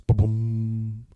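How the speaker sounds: drawn-out humming boom; imitated logo sound. Introducing the Netflix picks, imitated logo boom. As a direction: voice a streaming intro thump, let it ring.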